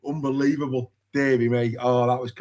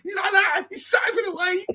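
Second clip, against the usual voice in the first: much higher, squeaky and laughing; giddy high voice. Real, not acted. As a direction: keep squeaking through the giggles. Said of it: "[name] does not talk like this normally".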